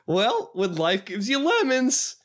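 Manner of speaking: high, slow, rising punchline; Vine-skit impression. Cereal guy holding a lemon, high hammy punchline. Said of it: "he says".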